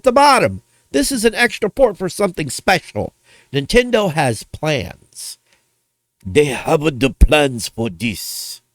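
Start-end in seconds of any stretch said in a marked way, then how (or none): 6.2-8.6 slow, lower mock Japanese accent; hammed-up Japanese accent, drawn out; bad Japanese accent. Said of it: "not very good at Japanese accents".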